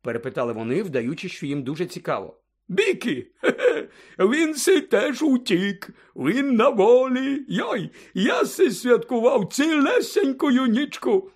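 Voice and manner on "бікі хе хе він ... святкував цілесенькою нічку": put-on high, drawn-out drunken glee with a chuckle; tipsy Hagrid, overjoyed. A narrator's reading. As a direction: crow the news slowly like a delighted drunk. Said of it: "і сяйливо посміхався".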